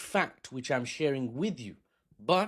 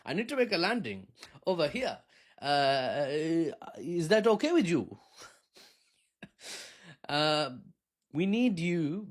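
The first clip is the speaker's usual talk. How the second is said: put-on higher, halting Indian accent; 3 semitones higher than usual. Pilot asking Maduro to land, put-on Indian accent. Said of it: "I'm not sure why we're Indian all of a sudden, accent-wise".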